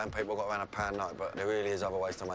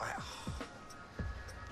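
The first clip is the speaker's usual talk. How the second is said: heavy sigh; 9 dB quieter than usual. Real, not acted. Guilt after the scam.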